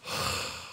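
long, soft exhale; weary breathy sigh; frustrated sigh. Real, not acted. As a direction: let out a wordless sigh of defeat. Frustrated hunting for map button.

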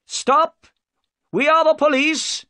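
very high, loud police shout; shouted order. A narrator's reading. Jones orders the fleeing boat to stop, shouting high. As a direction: yell across the water to halt them.

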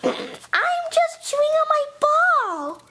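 high, slow put-on voice; bad imitation of Indy. Clone pretends to be Indy chewing a ball, in a false high voice. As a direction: stretch it out high, badly faking another voice. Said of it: "that doesn't sound like Indy at all".

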